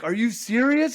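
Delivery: high-pitched re-enacted disbelief; incredulous. To a rediscovered best friend, incredulous. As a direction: replay the thrilled disbelief, pitched well up. Retold reunion with long-lost friend.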